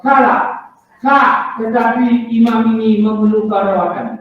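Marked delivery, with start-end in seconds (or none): none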